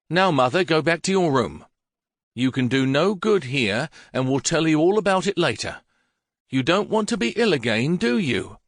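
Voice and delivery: a hint of a son soothing his frail mother; coaxing son. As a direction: talk her back to bed gently but firmly. Son coaxes mother back upstairs.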